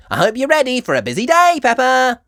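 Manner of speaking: high, loud and fast; a bustling busy rabbit; Miss Rabbit. A narrator's reading. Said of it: "Miss Rabbit cried".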